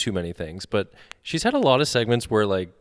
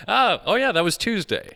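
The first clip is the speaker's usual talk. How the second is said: mock nonchalant; 9 semitones higher than usual. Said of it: "Just like it was normal".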